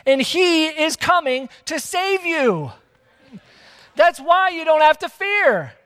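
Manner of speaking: high, ringing preacher's declaration; impassioned preaching. Real, not acted. Preacher assures congregation God is coming, impassioned preaching. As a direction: proclaim it with rising conviction, then ease down.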